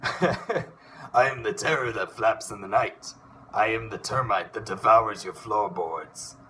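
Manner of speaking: bold cartoon duck hero declaiming his entrance; Darkwing Duck voice. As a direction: grandly announce each line like a caped duck hero. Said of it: "Darkwing Duck".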